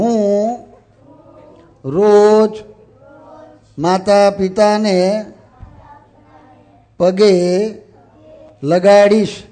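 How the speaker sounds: slow, drawn-out words with long pauses; measured call for group repetition; leading a repeat-after-me. Shown as a demonstration. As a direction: lead the pledge word by word, stretching each. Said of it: "તમે હું બોલું એમ બોલજો".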